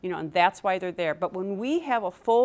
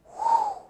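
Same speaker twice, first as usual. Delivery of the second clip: sharp high exhale of a rush; mock adrenaline whew.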